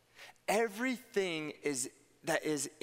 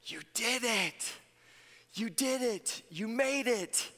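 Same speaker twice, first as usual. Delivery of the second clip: higher, slow and cheering; cheering a child; 6 semitones higher, slower than usual. Retold or imitated. Parents cheering their little boy after the long flight. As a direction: praise the boy for getting through the flight. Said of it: "they look to my nephew and they say".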